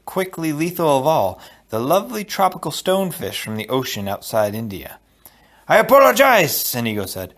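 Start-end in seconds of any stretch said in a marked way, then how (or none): 5.7-6.8 high, loud, emphatic character voice; exclaimed apology. A narrator's reading. Inigo to Fezzik after lying to him, exclaimed apology.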